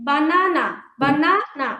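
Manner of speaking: বানানা in three even beats; three syllable demo. Shown as a demonstration. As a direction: tap out বানানা ba-na-na. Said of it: "তিনবারে আমরা উচ্চারণ করি".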